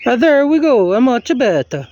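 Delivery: a loud, jokey mock Italian accent. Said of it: "doing an Italian accent".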